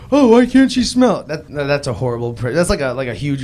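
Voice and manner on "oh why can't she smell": put-on high, louder girly question; lightly higher, louder girl voice; mock girl voice. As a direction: ask it like a curious girl. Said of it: "girls are always like".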